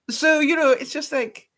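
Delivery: normal talking voice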